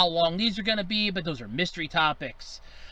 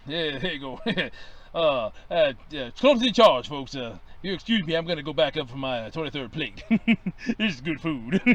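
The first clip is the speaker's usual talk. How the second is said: lightly lowered professor voice; Professor Crump voice; 5 semitones lower than usual. Acted. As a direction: keep the folksy professor voice. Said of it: "This is Professor Sherman T. Crump".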